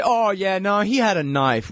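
high-pitched mocking quote; mock excuse. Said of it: "And they say".

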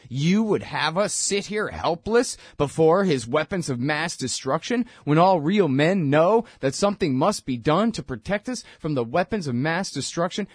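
normal talking voice

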